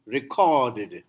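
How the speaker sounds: "recorded" as re-CORD-ed; verb stress. Shown as a demonstration. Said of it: "stress moves from first to the second".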